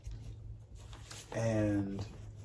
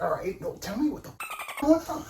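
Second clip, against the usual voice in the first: rising heated demand. Retold or imitated. Confronting his scene partner, climbing heated demand. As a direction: voice climbing higher in anger. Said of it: "I was like".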